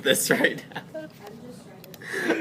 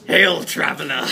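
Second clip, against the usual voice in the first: hammy courtly greeting. Acted. Player's grand greeting to hobgoblin.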